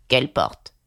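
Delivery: low, slow, drawling frog; slow dragging frog. A narrator's reading. Old frog answers Alice lazily, low and drawling. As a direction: drag every word out. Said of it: "si lentement, d'une voix si traînante".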